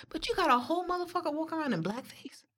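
unvoiced whisper, hushed and conspiratorial; whispered aside. Real, not acted. Friend whispering a risky joke to the group, hushed whisper. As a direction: whisper it like you shouldn't say it.

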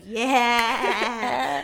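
loud, drawn-out laid-back yeah; relaxed code-switched yeah. Retold or imitated. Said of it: "look over to my right and be like".

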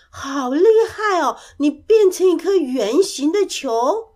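high, loud admiring exclamation; loud praise. A narrator's reading. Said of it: "大声的赞美". (非常佩服地大声赞美)

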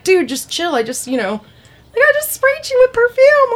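high-pitched whiny guy voice; mock high squeaky dude. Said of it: "And he's like".

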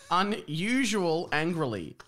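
"Unusual angrily" drawn out at half speed; slow mocking repeat. Shown as a demonstration. Co-host picking at bad grammar, slow and pointed. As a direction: dwell on each word of "Unusual angrily".

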